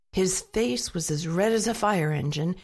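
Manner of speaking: ordinary delivery, nothing marked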